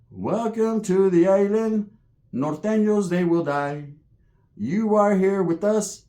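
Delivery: drawn-out, hammed-up ceremonial delivery; islander welcome. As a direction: intone it like an imagined island chant. Island chant flashes in his mind.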